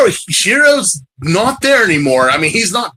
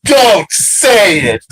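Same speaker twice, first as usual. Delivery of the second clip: slow, louder pleading don't; pleading yell; 3 dB louder, much slower than usual. Retold or imitated. Cringing at the Superbad scene, slow loud plea. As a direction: beg him to stop, loud and slow. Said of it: "I'm like".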